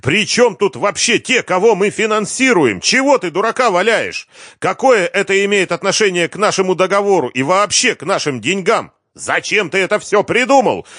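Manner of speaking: a run of high, loud shouted questions; acted yelling. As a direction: keep yelling question after question. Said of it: "заорал Юрий".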